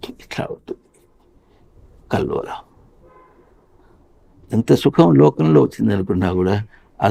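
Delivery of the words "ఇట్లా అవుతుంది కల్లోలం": whispered aside. Real, not acted.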